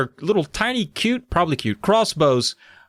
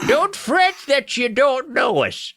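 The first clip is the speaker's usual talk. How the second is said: high, drawn-out squeaky gnome voice; 12 semitones higher, slower than usual. Acted. Old gnome reassures lost girls, squeaky. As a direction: squeak it slowly like a little gnome. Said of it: "he said in his squeaky voice".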